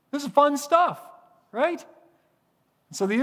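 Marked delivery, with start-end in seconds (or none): 0.1-1.8 mock cheerful sarcasm. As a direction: sell the misery as fun, pitched up. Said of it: "they were being sarcastic".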